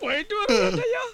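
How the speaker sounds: tearful goodbye. Acted. Prisoner leaves to see his mother.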